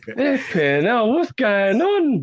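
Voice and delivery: slow, high, mock outraged; high drawn-out disbelief. Real, not acted. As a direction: stretch it out in disbelief.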